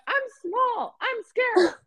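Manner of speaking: tiny, very high-pitched helpless squeal; mock frightened. Small bystander amid bar fights, high mock-frightened voice. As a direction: plead small and scared, hammed up.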